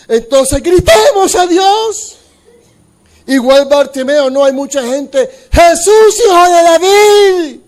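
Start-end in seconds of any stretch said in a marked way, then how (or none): 0.1-2.2 rallying call. Acted. People deciding to cry out. 5.5-7.6 crying out. Acted. Bartimeo shouting again.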